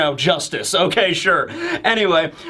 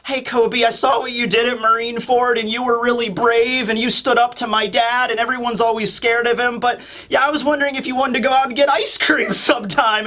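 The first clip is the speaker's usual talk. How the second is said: put-on higher-pitched girl asking someone out; playful mock-sweet character voice; Hibari; 4 semitones higher than usual. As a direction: lightly imitate a shy admirer's invitation. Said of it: "Hibari is just like".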